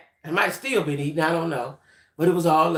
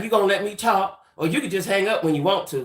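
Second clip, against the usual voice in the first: normal talking voice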